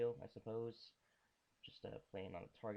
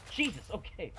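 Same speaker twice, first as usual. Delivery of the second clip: ordinary talk, nothing marked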